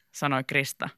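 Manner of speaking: plain reading voice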